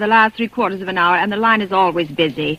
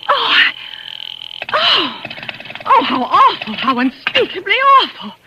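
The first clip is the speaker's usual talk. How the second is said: horrified crying out; 4 semitones higher, slower than usual. Acted. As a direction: break down in horror, crying. Horror at the murder plan.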